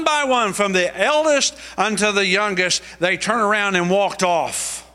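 high, pressing preaching voice; fervent preaching. Acted. Preacher telling the congregation the adulteress story, in full preaching voice. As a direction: preach it up to the climax.

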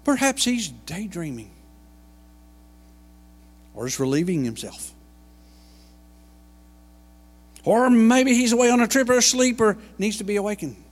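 sarcastic, loud ridicule; mocking. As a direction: keep needling them with scorn. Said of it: "he scoffed".

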